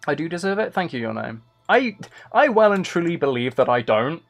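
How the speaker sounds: normal talking voice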